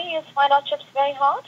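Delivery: ordinary talk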